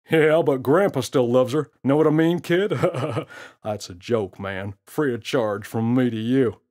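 put-on a cocky gorilla teasing the kid; smirking gorilla. Gorilla voiced in story.

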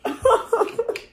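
breathy high-pitched laugh. Real, not acted. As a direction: burst out laughing. Bursts out laughing.

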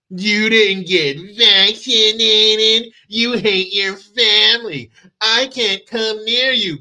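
a hint of a preachy know-it-all, slow in places; lightly mock a self-righteous scold; smug vaccinated person. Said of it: "people who are elevated onto their high horse".

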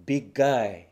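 "Big guy" as one unit with a single G; linked G sounds. Shown as a demonstration. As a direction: model "Big guy" joined, for repeating. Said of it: "同じようにbig guyがbig guy".

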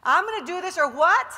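loud, rising rally cry; a confident challenge to the room; declare it loudly. Shown as a demonstration. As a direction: shout it like you mean it. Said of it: "You gotta say it like you mean it".

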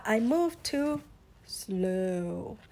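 slow drawl sinking on the last word; slow turtle. Acted. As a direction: sluggish turtle voice.

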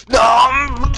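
high crying shout; anguished shout. Real, not acted. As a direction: cry out in despair. Fails, cries out.

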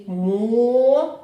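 «Мо» loud and long; syllable demo. Shown as a demonstration. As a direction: hold the single syllable «Мо». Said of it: "Давайте промовимо". (голосно, протяжно, окремим складом)

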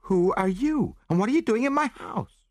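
very high, alarmed woman's voice; indignant woman. A narrator's reading. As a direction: challenge the intruders in a high voice. Woman challenges intruders in her house.

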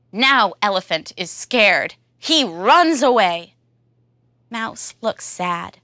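ordinary delivery, nothing marked

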